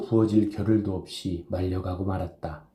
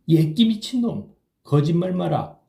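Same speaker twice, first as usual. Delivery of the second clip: scoffing disbelief; 9 semitones higher, 3 dB louder than usual. A narrator's reading. Chisam disbelieving the news.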